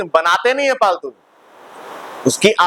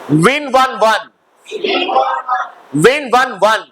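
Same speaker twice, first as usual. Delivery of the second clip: higher, projected teacher recitation; classroom drill; 4 semitones higher, slower than usual. Acted. Teacher drills verb forms and meanings, drilling voice. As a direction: lead a class in repeat-after-me verb forms.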